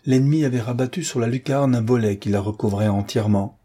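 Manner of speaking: plain reading voice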